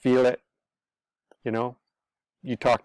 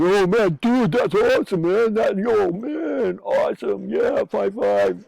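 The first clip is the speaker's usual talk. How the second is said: much higher, louder gushing dude voice; hyped-up bro friends; 10 semitones higher, 5 dB louder, slower than usual. Retold or imitated. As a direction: gush like an impressed buddy admiring the new toy. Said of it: "and they're friends".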